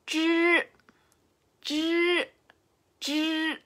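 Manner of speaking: Zhi high, retroflex, unaspirated; Chinese zhi. Shown as a demonstration. Teacher practising zhi with viewers. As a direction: watch my mouth: zhi. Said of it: "Parece que mis dientes son pegados".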